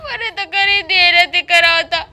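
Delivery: wife fake-cries and complains about never getting a dress, loudly; fake crying complaint. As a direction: wail to guilt the husband into paying. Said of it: "સારી લાગે છે હો તું રડતી હોય તો".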